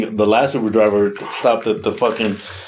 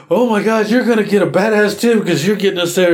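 excited passengers; 7 semitones higher than usual. Retold or imitated. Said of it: "The girls are like".

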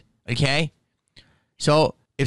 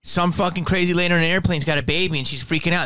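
ordinary talk

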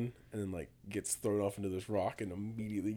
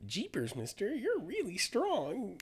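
put-on wholesome 1950s kid; 12 semitones higher, slower than usual. Imitating the disguised kids.

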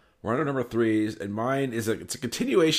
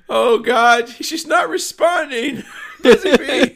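shrill, much higher and louder panic, slowed; frantic dog owner; 12 dB louder, slower than usual. Retold or imitated. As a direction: play the hysterical owner losing her dog.

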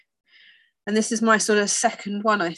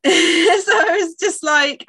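laughing; 8 semitones higher, 6 dB louder, slower than usual. Real, not acted.

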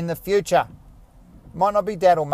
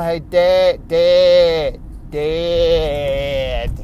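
put-on Australian kid voice; 7 dB louder, much slower than usual. Dad imitating his future daughter calling him, Australian accent. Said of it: "That's very Australian".

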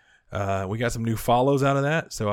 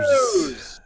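high, loud excited shout; announcing big news; 13 semitones higher, 6 dB louder than usual. Pretend. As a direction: trumpet the word like a jingle. Show news segment intro.

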